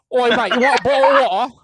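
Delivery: high, loud mock British lad; mock British accent. Mocking British gamers in group calls, mock British accent. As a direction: ham up a cheeky London lad. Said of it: "heard their accents enough".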